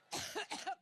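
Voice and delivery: harsh dry cough; coughing fit. Real, not acted. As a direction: cough hard, can't stop. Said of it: "I get allergic".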